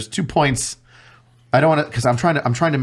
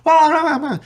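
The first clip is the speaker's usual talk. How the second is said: high mocking blah blah; high sing-song mockery; mock rambling; 3 dB louder than usual. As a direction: brush off the babble in a high silly voice.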